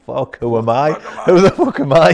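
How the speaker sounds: loud, high rising panic; panicked thought. Retold or imitated. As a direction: blurt out rising alarm. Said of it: "I'm thinking".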